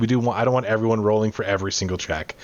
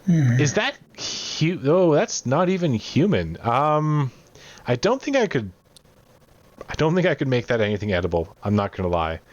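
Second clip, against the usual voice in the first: higher-pitched, hesitant cook; taken-aback cook; 5 semitones higher than usual. Acted. Cook is offered a hand as meat, high and taken aback. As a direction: stumble in high-voiced dismay, then settle down.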